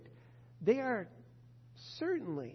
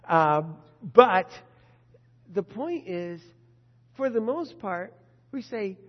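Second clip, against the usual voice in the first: usual speaking voice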